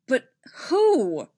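loud, high burst of frustration; frustrated outburst, pitch leaping up. A narrator's reading. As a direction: demand it in exasperation.

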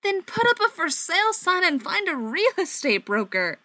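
high, loud near-yell; almost yelling. Acted. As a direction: nearly shout it at him. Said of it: "Mike all but yelled".

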